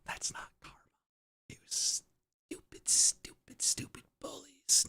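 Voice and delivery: whispered grumble, very soft; sulky whisper. Real, not acted. Streamer wounded by chat's karma teasing, sulky whisper. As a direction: whisper it bitterly under your breath.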